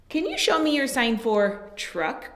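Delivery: ordinary delivery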